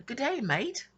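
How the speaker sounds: playful fake Australian accent, high and bright; mock Aussie greeting. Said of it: "I told my sister that. We both laughed".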